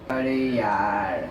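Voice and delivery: very low, long drawn-out groan of words; slow, soft, sinking dismay; exasperated. Acted. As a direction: drag it out low, utterly fed up. Exasperated at the friend on phone.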